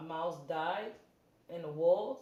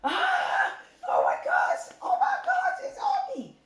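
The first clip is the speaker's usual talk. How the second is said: much higher, louder terrified shrieks; retelling the water bug with real-sounding shrieks; panicked screaming; 7 dB louder than usual. Retold or imitated. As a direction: scream like a bug is crawling on you. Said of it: "I'm screaming like".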